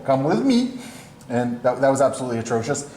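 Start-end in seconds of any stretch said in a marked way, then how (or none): 0.1-0.8 a deliberately bad Russian accent, pitched up; hammed-up Russian strongman accent; worst Russian accent. Colossus carrying the Senator off, in a bad Russian accent. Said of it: "in his worst Russian accent".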